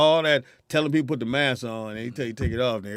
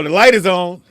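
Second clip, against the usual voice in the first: loud, high call from the crowd; heckling yell; 6 semitones higher, 12 dB louder than usual. Retold or imitated. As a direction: shout it to hurry the comic off. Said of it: "Or they go".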